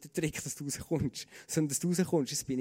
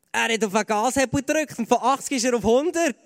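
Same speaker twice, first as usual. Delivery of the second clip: lauter und höher, die Pointe rausgehauen; aufgeregt erzählt; 6 semitones higher, 9 dB louder than usual. Real, not acted. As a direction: voller Begeisterung die Wende erzählen. Lebhafte Pointe der Autobahngeschichte. (begeistert und laut die Pointe bringen)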